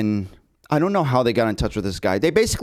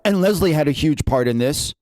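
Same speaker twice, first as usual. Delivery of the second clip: usual speaking voice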